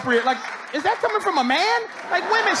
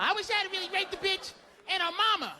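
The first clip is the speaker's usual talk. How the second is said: quick, higher-pitched mock outrage; retold anger; 6 semitones higher, 7 dB quieter, faster than usual. Comic retells boxer's answer to interviewer, mock angry outburst. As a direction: imitate an accused man's angry outburst. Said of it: "could be that angry".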